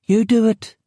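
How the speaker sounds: put-on high child pushing back; a girl refusing, high and cheeky; sulky child. A narrator's reading. Claire refusing her mother, high sulky child. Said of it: "Claire said".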